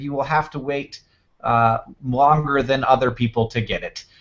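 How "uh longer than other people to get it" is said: usual speaking voice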